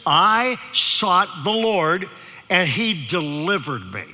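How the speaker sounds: each word weighed out slowly; slow emphatic verse. Acted. Preacher reading scripture slowly to the congregation. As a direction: lean on the verse, slow and deliberate.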